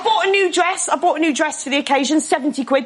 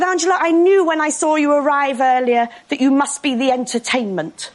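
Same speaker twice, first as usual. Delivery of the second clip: exaggerated posh British accent; strained upper-crust posh English; very posh woman; 3 semitones lower than usual. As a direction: speak as if being this posh physically pains you. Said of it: "One of those people so posh".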